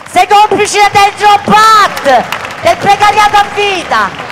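rising shouted rally cry; booming balcony speech. Real, not acted. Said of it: "mi dicono che grido".

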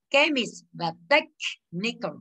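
"chemist" and "technical" read slowly and carefully; slow pronunciation model. Shown as a demonstration. Teacher modelling "chemist" and "technical" to students, slowly. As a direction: pace out "chemist" and "technical" for the class. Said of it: "Character, teacher".

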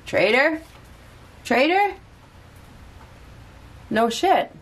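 shocked, pitch jumping high; high disbelieving double take; surprised. Real, not acted. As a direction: gasp it out as a question. Reacting to the Ten of Swords.

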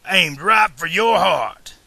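loud, drawn-out outlaw yell; villain's slow shouted taunt; screamed threat. A narrator's reading. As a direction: yell it slowly as a menacing threat. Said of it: "Buford screamed".

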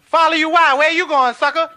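loud, high, incredulous shout; hammed-up theater heckling; heckler. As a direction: jeer at the man on stage. Theater audience heckles the guru.

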